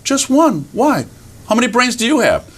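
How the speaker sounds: high, curious little animal voice; innocent raccoon. Acted. Raccoon answers the boasting fox, innocently curious. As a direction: answer simply, then ask back high. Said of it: "And raccoon looks up".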